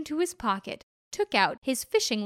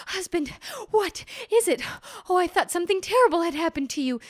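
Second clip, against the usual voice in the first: higher, breathless and fast alarm; worried wife; 6 semitones higher, faster than usual. Acted. Wife running up to her husband, worried and fast. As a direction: rush out worried words.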